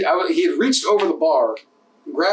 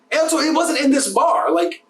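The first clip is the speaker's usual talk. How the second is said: building retold rant; 8 semitones higher than usual.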